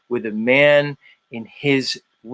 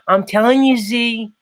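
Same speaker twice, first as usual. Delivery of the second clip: higher-pitched Chicago accent impression; 5 semitones higher than usual. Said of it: "[name] trying to do a Chicago accent".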